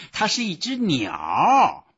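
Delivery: loud, high, drawn-out explanation; high emphatic cry, last word stretched; exasperated explaining. A narrator's reading. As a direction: spell out the obvious loudly and slowly. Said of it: "波普先生解释道".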